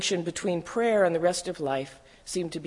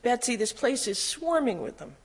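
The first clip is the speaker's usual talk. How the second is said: higher, dismayed exclamation; mild cry of alarm; 7 semitones higher than usual. A narrator's reading. Woman warning her sister of fleas, mild cry of alarm. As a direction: lift the pitch in understated alarm. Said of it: "I cried".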